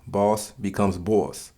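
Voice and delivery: slow demo, second "boss" drawn out like "bawss"; New York vowel. Shown as a demonstration. Teaching the New York O sound, slow "bawss" demo. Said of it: "the use of the letter O, like an A U".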